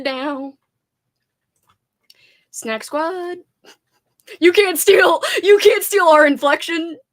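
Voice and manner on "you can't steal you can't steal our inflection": playful shout. Real, not acted. Claiming the film crew cheer.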